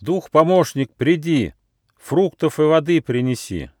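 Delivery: high-pitched summons; calling out. A narrator's reading. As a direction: call up a helper spirit in rhyme. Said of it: "он позвал".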